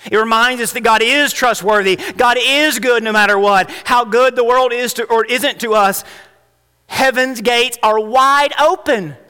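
high, loud, rising sermon peak; full-force preaching. Acted. Pastor pressing the church on fear, full-force preaching. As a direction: preach it out to the congregation.

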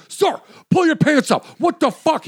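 a guard losing it at a visitor; guard freaking out. Retold or imitated. Prison guard yells at a visitor pulling pants down, high panicked shout. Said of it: "the guard freaks out".

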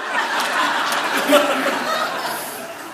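airy rising laugh; breathy laugh. Real, not acted. Laughs at the wife's line.

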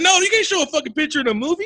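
high, fast, panicked objection; alarmed outburst. Retold or imitated. Viewer yelling at the screen in alarm. Horrified objection to movie scene.

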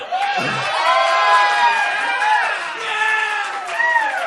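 shrill burst of laughter; loud high laugh. Real, not acted. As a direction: cackle high and loud at yourself. Host laughs at his own boast.

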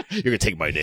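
laughing attempt at an Australian accent. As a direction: put on a rough Aussie accent through laughter. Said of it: "Was that your best Australian accent?".

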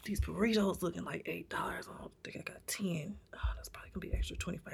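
hushed, fast, counting money; whispered inner worry. Retold or imitated. Imagining checking her wallet at the counter, whispering. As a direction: whisper it to yourself, worried. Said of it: "I would have been like".

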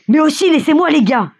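high, eager call from the crowd; happy shout. A narrator's reading. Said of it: "crie un type dans la foule tout heureux". (cri joyeux, voix haute)

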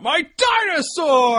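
high, slow triumphant shout; excited shout. Retold or imitated. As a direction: yell it like a proud kid. Quoting movie line.

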